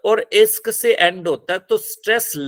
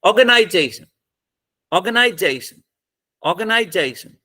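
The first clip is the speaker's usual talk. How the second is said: "Organisation" with "जे" punched and "शन" dropping light; stress on जे. Shown as a demonstration. Teacher drilling the word for students, "जे" strongest in organisation. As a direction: drill "organisation", leaning hard on "जे". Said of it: "जई, जई पे".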